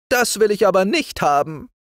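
high-pitched firm objection; objecting old shepherd. Acted. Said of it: "sagte Arend Schieper".

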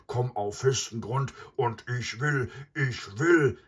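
loud, high, furious buffalo tirade; outraged, high-pitched ranting; foaming with rage. A narrator's reading. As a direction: rage at the intruder as an enraged bull. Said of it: "Mysa schäumte vor Wut".

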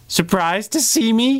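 high, bright-voiced grinning villain with a wide grin. A narrator's reading. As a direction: sing out the apology like a grinning little menace. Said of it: "he beamed".

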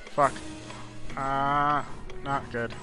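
ordinary talk, nothing marked

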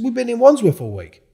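high, loud, insistent; retold heated alibi. Telling the accuser where they really were, loud. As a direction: hammer home the alibi.

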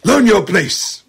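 hammed-up father laying down the law; stern father. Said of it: "it's like".

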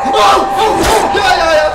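high loud panicked gasps; frightened cries. Real, not acted. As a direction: cry out in fear.

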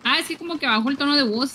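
ordinary talk

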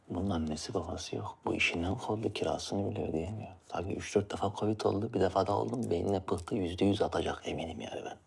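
low, soft confiding murmur; hushed gossip. Real, not acted. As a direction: whisper the gossip to your partner.

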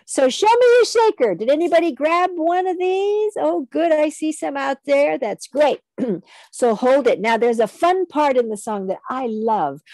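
ordinary talk

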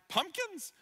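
high disbelieving question; can't believe what he heard; incredulous. Retold or imitated. Pastor baffled by God's odd instruction, incredulous.

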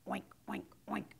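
short imitated oinks; storybook pig noises; pig grunt. A narrator's reading. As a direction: oink like a pig, spaced apart. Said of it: "grunted the pig".

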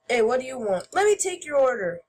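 ordinary delivery

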